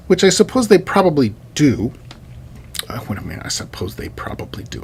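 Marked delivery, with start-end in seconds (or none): 2.9-4.8 very soft, near-whispered aside; muttering to self. Real, not acted. Lecturer second-guessing his own remark, muttering to himself. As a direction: drop low and question yourself under your breath.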